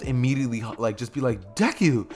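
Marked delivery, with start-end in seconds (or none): 1.5-2.1 higher-pitched mock startled call; shocked cry. Parents see Deku is alive, imitated shock. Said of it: "they would both be shocked to have him".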